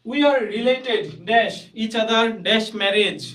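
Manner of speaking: slow dictation reading. Shown as a demonstration. Teacher reads question sentence.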